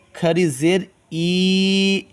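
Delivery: ای held long and slow; drawn-out long i. Shown as a demonstration. Teacher drills students on long ای. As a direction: stretch the ای like a chant. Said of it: "تھوڑا کھینچیں گے".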